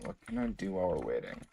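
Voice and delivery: fast, soft muttered repeat; quiet mutter. Real, not acted. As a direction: mumble it to yourself. Repeats question, second muttered.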